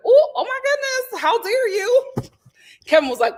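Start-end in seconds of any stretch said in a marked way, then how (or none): 0.1-2.1 high, loud gasp of mock offence; shocked gossip reacting to an insult on TV; mock outrage. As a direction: clutch your pearls, scandalized. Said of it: "I was like".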